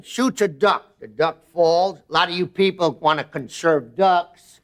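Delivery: normal talking voice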